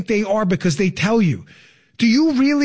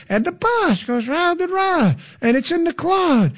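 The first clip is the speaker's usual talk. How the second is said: high, drawn-out mock politician; mocking higher-pitched impersonation; Kamala Harris; 7 semitones higher than usual. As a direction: parody a politician explaining the obvious. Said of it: "saying more than Kamala Harris".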